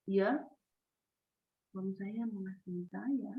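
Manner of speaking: very soft, halting wife; hushed, reluctant reply after a long pause; hesitant, sad. Shown as a demonstration. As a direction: barely bring yourself to say it. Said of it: "Perhatikan ekspresi saya".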